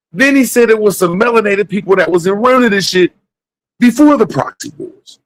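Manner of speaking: exasperated rant to viewers; heated rant. Real, not acted. Ranting, voice rising.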